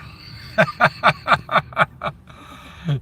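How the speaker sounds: high breathless giggling laugh; long airy giggle. Real, not acted. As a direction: giggle at your own cheeky joke. Laughs at lot lizards joke.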